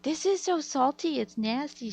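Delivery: high-pitched mom imitation; exaggerated complaining mother. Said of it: "And she's like".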